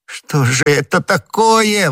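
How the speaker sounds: slow, high cry of despair; despairing, drawn-out question; desperate exclamation. A narrator's reading. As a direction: wail it out, stretched and high. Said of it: "воскликнул галантерейщик в полном отчаянии".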